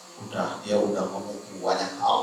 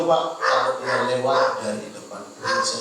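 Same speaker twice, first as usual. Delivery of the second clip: ordinary talk, nothing marked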